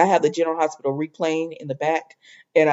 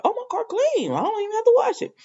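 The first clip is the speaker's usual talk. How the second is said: much higher, fast happy chirp; high, delighted retelling of her relief; mock delight; 4 dB quieter, faster than usual. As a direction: sound thrilled about the clean car. Delighted at clean car.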